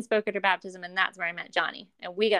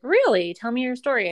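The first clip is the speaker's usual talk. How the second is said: mildly higher, louder burst of eager interest; excited dad; 5 semitones higher, 7 dB louder than usual. Retold or imitated. Dad hearing his daughter's news, excited. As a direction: imitate an excited, encouraging dad. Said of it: "He was so excited for me".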